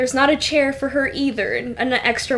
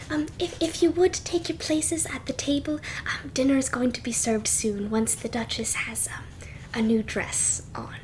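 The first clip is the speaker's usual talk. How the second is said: soft, whispered servant's announcement, fading to breath; hushed maid; 8 dB quieter than usual. Acted. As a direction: tell the guests quietly, barely voiced. Shy maid Nisa announces dinner.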